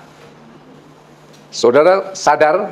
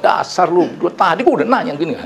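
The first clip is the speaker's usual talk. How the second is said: high-pitched, quick imitated telling-off; mock angry scolding; 6 semitones higher than usual. Employer scolding helper over lost keys, mock angry. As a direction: act out snapping at the helper, half in fun. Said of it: "saya marah".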